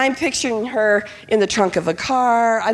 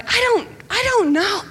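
panicked; 10 semitones higher than usual. Retold or imitated. Panicked mom answering questions.

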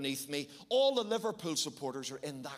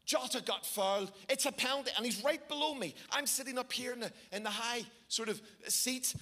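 usual speaking voice

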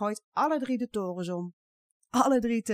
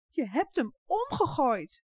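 angry shout; 8 semitones higher than usual. A narrator's reading. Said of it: "roept Jip woedend".

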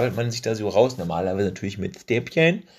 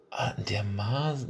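ordinary talk, nothing marked

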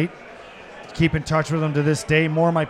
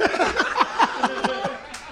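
high, airless wheezing laugh; breathless laugh; 11 semitones higher than usual. Real, not acted. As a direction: wheeze with laughter, barely voiced. Cracking up at the memory.

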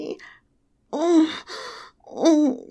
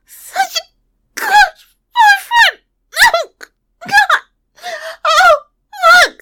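much higher, much louder crying out; unrestrained climax cries; 9 semitones higher, 11 dB louder than usual. Acted. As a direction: peak and break into cries. Climax, high loud cries.

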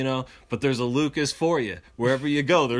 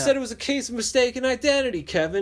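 indignant protest; 11 semitones higher than usual. Retold or imitated. Pleading with the bully.

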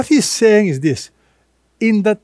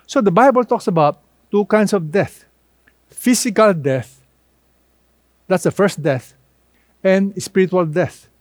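ordinary delivery

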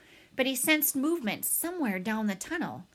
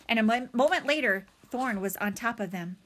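usual speaking voice

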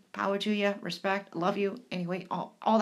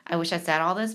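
usual speaking voice

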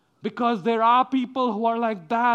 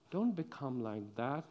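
ordinary delivery

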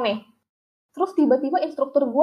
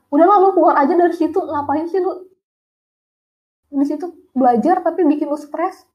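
higher-pitched, mildly scolding imitation; exasperated friend; 5 semitones higher than usual. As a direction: imitate a friend telling someone off. Friend urging her to quit.

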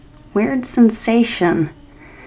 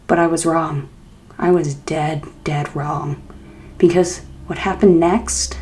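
usual speaking voice

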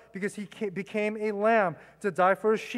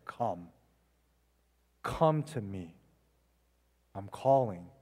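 gentle voice; 12 semitones lower, 5 dB quieter, slower than usual. Acted. Gentle voice of the shepherd calling.

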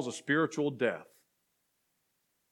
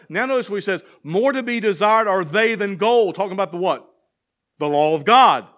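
raised, loud pulpit proclamation; fervent sermon cadence, climbing in pitch; full-force preaching; 6 semitones higher, 8 dB louder than usual. Acted. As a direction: preach the psalm out to the back row. Preaching Psalm nineteen aloud.

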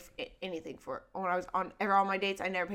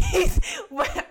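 bursting into laughter; laughing; 12 semitones higher, 3 dB louder than usual. Real, not acted. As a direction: laugh it out loud. Laughing at running from date.

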